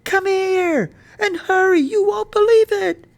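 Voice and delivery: high, loud urgent hollering; excited shout. A narrator's reading. Boy urging Mama to see the red fern, shouting. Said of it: "I shouted".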